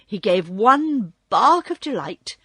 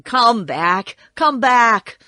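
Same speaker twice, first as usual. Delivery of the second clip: loud call, louder on the repeat; 3 semitones higher, 8 dB louder, slower than usual. A narrator's reading. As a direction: call after fleeing mice, building in volume. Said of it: "while he called to the mice".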